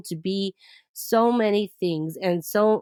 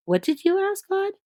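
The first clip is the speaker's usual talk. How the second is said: astonished question; 12 semitones higher than usual. Retold or imitated. Driver stunned by her request.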